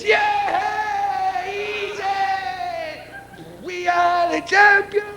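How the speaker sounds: very high whooping yell, champions line slow; triumphant high cry. Acted. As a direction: crow in triumph as he falls. Triumphant cry while falling.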